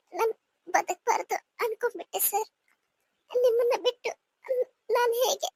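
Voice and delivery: soft, tearful confession through sobs; weeping quietly as she admits it; crying. Acted. Wife confesses suicide attempt to husband. (ಅಳುತ್ತಾ ಮೆಲುದನಿಯಲ್ಲಿ)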